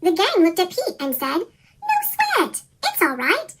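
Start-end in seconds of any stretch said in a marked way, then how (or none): none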